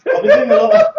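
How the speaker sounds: high, loud excited start; mock hype. Comic boasting to the crowd, high loud hype. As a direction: burst out all pumped up.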